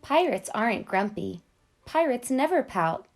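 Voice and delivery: ordinary delivery, nothing marked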